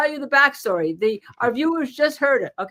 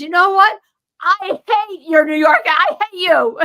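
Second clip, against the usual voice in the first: loud, higher retold outburst at the lawyer; mock angry juror; 7 semitones higher, 8 dB louder than usual. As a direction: belt it out like the fed-up juror. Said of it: "the guy says".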